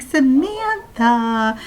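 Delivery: slow, sing-song reproach; mock warning. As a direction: stretch out the name as a playful scold. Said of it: "she's like".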